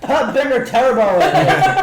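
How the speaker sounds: high, loud mimicked little kid; four-year-old girl. Four-year-old passing on a fed line, loud child imitation. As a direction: shout it like a small child, through laughter. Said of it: "And she's like".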